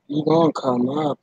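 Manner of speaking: normal talking voice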